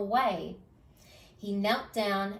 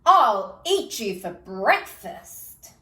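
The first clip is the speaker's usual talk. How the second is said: loud, slow menacing troll; 9 dB louder, slower than usual. Acted. Troll threatens.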